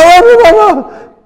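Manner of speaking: very high, loud, hammed-up panic; worked-up believer crying out to God, hammed up; mock frantic prayer.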